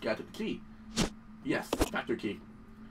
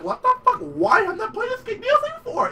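much higher amazed outburst; amazed disbelief. Retold or imitated. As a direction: exclaim in stunned disbelief. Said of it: "I'm like".

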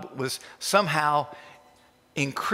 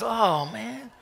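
higher, mock-whiny groan; a preacher mocking complainers with a whiny sigh, higher; mock whine; 6 semitones higher than usual. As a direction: whine like a sulking dog. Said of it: "they whine like a big dog".

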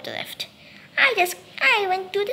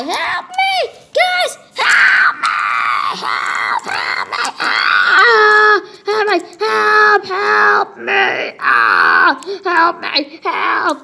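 desperate cries for help; 2 semitones higher, 4 dB louder, much slower than usual. Acted. Victim screaming to friends while being taken. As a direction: scream for help as you're dragged.

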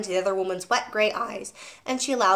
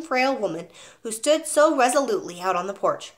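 usual speaking voice

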